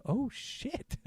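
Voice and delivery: hushed, high-pitched oh-shit; quiet, high little gasp of realization; stunned aside. Retold or imitated. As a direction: squeak it softly to yourself as it dawns. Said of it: "and I'm like".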